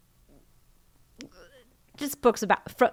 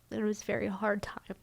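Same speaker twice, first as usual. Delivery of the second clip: soft, breathy whisper, a little quick; real whispered admission of a hard time; whispered confession; 8 dB quieter, faster than usual. Real, not acted. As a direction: let it slip out under the breath. Confessing a painful period.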